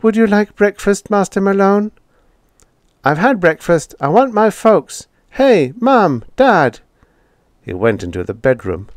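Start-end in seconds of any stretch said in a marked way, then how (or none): 0.0-1.9 high, polite servant-robot voice; house robot. A narrator's reading. House robot greeting the boy, high and polite. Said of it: "The robot that tended the house". 3.0-6.8 put-on high, louder boy's voice, insistent; boy demanding. A narrator's reading. Boy calling for his parents, high and insistent.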